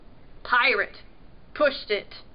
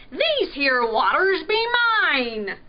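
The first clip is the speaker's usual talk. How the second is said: loud, higher pirate growl; 4 semitones higher, 6 dB louder than usual. A narrator's reading. Pirate claiming his waters from the prince, growling. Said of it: "Pirate growled".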